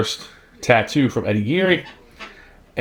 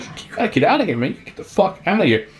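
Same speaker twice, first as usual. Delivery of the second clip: retold ejection; 8 semitones higher than usual. Staff order him out, retold.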